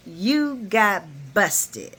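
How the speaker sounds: very slow, drawn-out sassy imitation; sassy head-twang. Repeating Carly's jab at Nina for laughs, slow and sassy. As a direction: stretch each word with mock swagger. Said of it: "she added that head twang".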